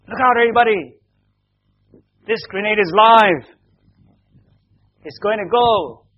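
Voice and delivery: loud, high mock alarm shout; shouted warning. Soldiers faking a live grenade alarm to everybody, shouting. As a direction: shout an urgent warning, acting it out for children. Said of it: "they s-, they shouted".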